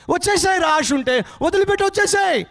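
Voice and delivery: very high-pitched command yelled across water; urgent shouting. Acted. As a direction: yell at him to come back. Villagers yelling back at drowning man. (గట్టిగా అరుస్తూ పిలుపు)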